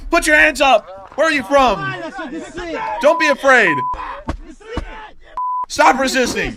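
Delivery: loud, high commands at a prisoner; shouted orders. Retold or imitated. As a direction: bark orders to surrender. Soldiers order surrendering Russian.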